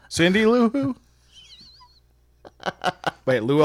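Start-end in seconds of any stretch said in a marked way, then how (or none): 0.1-0.9 high. Acted. Playful pun, pitch jumps up.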